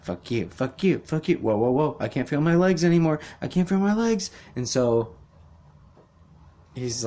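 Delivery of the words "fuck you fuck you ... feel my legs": higher, faster, rising into a panicked yelp; mock kid panic, getting higher and higher; panicking kid. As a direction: play the kid losing his footing in the pool and panicking. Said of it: "he's like".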